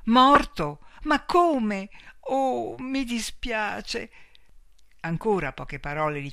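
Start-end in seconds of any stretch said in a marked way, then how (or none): 0.1-0.8 much higher, much louder outburst; the truth hits her all at once; shocked cry. A narrator's reading. Shock repeats news on phone. 1.0-4.1 higher, slowing to a sad apology; shaken sorrow. A narrator's reading. Shocked reaction to death news.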